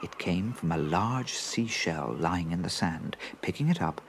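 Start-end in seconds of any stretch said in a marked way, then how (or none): none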